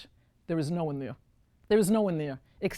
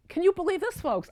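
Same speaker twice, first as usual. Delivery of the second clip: amazed question; 12 semitones higher than usual. Real, not acted. Astonished aside to audience.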